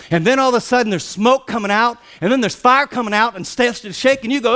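high, driving sermon storytelling; excited preaching. Real, not acted. Preacher describes smoke and fire on the mountain, excited preaching. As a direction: build the drama of the mountain.

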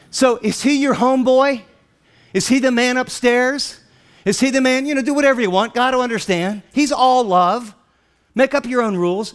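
high, forceful rhetorical questions; challenging preaching. Real, not acted. Preacher challenges casual ideas of God, forceful preaching. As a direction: press the congregation with each question.

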